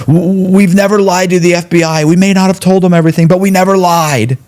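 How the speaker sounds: high, fast whiny mock voice; husband standing up to deny lying, mocked high. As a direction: parody the husband's indignant denial. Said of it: "her husband stands up and he goes".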